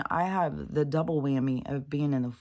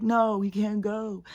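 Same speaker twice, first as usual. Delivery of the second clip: slow, higher-pitched plaintive refusal; mock protest; 6 semitones higher, slower than usual. Imagining herself keeping the puppy, mock protest. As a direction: drag it out, not wanting to let go.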